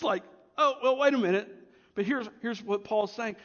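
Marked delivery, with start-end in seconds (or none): 0.6-1.5 raised, louder catch-yourself moment; playful backtracking; mock sudden recollection. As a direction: interrupt yourself as a memory lands. Acting out Paul correcting himself.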